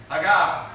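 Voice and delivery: "Hagah" with the second syllable punched loud; "Hagah", accent landing on the last syllable; stress on second syllable. Shown as a demonstration. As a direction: hit the end of "Hagah" hard. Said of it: "Accent on the second syllable".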